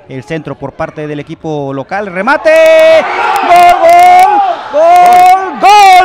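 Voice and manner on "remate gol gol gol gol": long high goal scream; breakneck goal commentary. Real, not acted. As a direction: yell the goal with full lungs. Local team scores a goal. (grito de gol desaforado)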